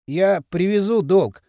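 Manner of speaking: ordinary delivery, nothing marked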